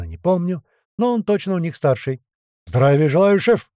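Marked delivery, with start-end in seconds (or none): 2.6-3.7 barked salute. A narrator's reading. Senior fighter greeting his chief, barked. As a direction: bark it out to the chief. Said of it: "рявкнул тот".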